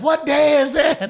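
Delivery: high, loud shout to the table; laughing, excited holler; pit boss calling out. Retold or imitated. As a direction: boom the question at a crowd. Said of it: "the pit boss is like".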